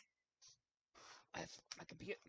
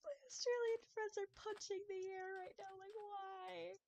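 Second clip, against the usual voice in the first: much higher, laugh-choked wail; squealing laughter; 13 semitones higher, slower than usual. Real, not acted. Streamer picturing Australian friends' despair, high laughing wail. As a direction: squeal it through helpless laughter.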